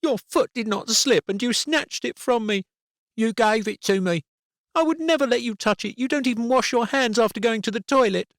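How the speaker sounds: put-on high child's voice, loud and spiteful; high-pitched angry child; snarling girl. A narrator's reading. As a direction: snarl it at him like a furious little girl. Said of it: "Abby snarled".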